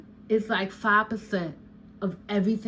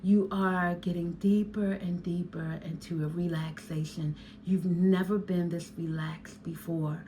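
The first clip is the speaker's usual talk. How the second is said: hypnotic induction; 3 semitones lower, 5 dB quieter than usual. Shown as a demonstration. Hypnotherapist shows a client the induction, soft and low. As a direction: ease them deeper into calm. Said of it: "yes, I'm gonna say".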